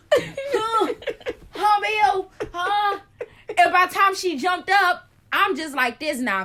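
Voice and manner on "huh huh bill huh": high, laughing mock-confused huhs; groggy friend. Friend jolts awake, high, mock-confused and laughing. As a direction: play the startled half-asleep friend.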